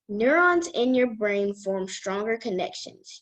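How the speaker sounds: ordinary talk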